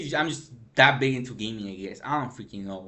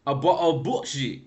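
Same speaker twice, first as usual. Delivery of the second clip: mock British; 6 dB louder than usual. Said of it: "British freaking saying".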